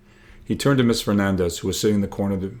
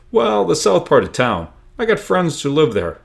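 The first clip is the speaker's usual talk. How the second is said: joking; 8 semitones higher, 5 dB louder than usual. Acted. Isaac jokes about going south.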